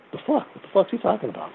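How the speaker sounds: high, soft incredulous mumble; quietly puzzled, voice jumping high; baffled reaction. Retold or imitated. Acting out his baffled reaction to stranger.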